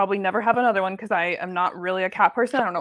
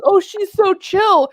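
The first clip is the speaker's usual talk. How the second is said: slow, louder, higher imitation of the friend; friend's drawn-out admiring remark; 9 semitones higher, 6 dB louder, slower than usual. Friend holding the kitten, marveling slowly. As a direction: stretch it out like a dazzled friend. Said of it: "he's like".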